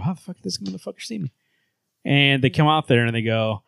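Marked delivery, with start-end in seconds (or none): 0.0-1.3 much softer, fast, baffled undertone; baffled soldiers. Retold or imitated. Soldiers stunned that Superman spotted them, fast hushed disbelief. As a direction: mutter it quick and stunned.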